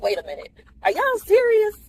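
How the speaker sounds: playing the actress realizing it's real; mock disbelief. Her hero at the airport voiced.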